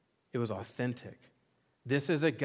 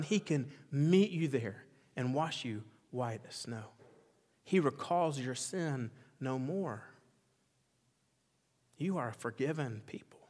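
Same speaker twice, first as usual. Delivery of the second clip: ordinary talk, nothing marked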